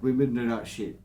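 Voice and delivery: ordinary delivery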